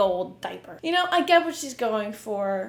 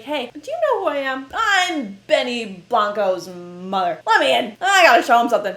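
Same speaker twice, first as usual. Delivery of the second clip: hammed-up demanding visitor, louder at the end; pushy baby mama; 7 dB louder than usual. Pretending to talk past Interscope security, loud mock demand. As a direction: bluff your way past security. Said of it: "I could just walk into Interscope and be like".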